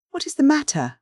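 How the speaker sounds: sharp high cry of alarm. A narrator's reading. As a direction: call out in sudden alarm. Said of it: "cried Random".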